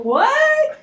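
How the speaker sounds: high, stunned disbelief; stunned what. Retold or imitated. Co-conspirator hearing the news, stunned. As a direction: shoot the word up in shock. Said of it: "His co-conspirator's going".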